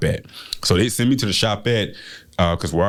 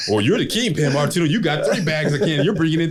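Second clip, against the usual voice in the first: accusing sibling; 8 semitones higher than usual. Retold or imitated.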